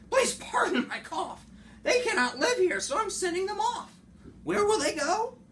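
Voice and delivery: high, loud reproachful Lorax; scolding Lorax. Acted. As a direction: scold him in a high fussy voice. Lorax complaining to the Onceler.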